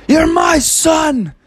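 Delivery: high, slow, anguished shout; movie quote shout. Retold or imitated. Vlogger acting out a father begging his son, shouting. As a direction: bellow it like a desperate father. Quoting Mel Gibson war movie.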